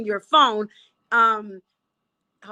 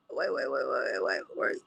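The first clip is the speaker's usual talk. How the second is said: low, soft mutter while losing her place; half under her breath, checking her notes; real muttering to herself, lower and softer; muttering to self; 12 semitones lower, 8 dB quieter than usual. Real, not acted.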